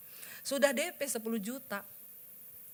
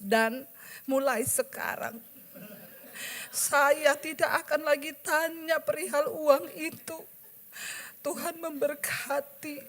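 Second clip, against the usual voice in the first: tearful writing; 5 semitones higher, slower than usual. Retold or imitated. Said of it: "Dengan bercucuran air mata".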